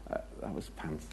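hushed verdict. Retold or imitated. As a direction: whisper it like a blunt confession. Quoted people mocking sermon.

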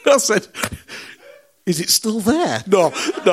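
laughing while telling the punchline; laughing through the joke. Real, not acted. Laughing at his own joke to the church. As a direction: giggles breaking through the words.

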